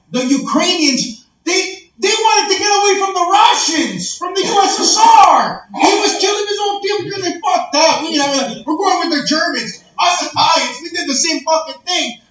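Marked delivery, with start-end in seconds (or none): 1.4-8.0 heated shout. Real, not acted. Heated rant about history.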